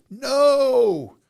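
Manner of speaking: long, high, very loud yell; screamed no. Retold or imitated. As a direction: scream it out at the top of your lungs. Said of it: "scream at the top of my lungs".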